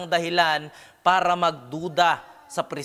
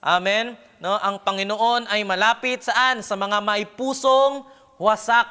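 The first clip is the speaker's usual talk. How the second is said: raised, higher preaching voice pressing each phrase; fervent preaching; 5 semitones higher, 5 dB louder than usual. Acted. Preacher exhorting the church, raised fervent preaching. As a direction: proclaim it to the whole congregation.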